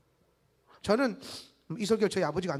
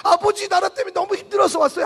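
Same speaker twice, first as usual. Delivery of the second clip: high, loud, hammed-up whimpering plea; a teasing fake sob, much higher and louder; mock tearful complaint; 10 semitones higher, 10 dB louder than usual. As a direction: play a counselee wailing in exaggeration. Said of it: "막 와가지고 막".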